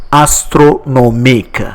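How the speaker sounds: astronomique in careful syllables, ending in -ik; French pronunciation. Shown as a demonstration. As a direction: pronounce astronomique the French way, clearly for learners. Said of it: "Remember the pronunciation".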